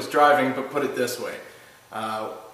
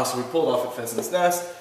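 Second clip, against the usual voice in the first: ordinary delivery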